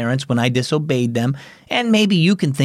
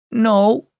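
sniffed; 7 semitones higher than usual. Acted. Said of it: "Kaya sniffed".

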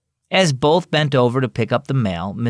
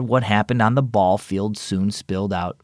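ordinary delivery, nothing marked

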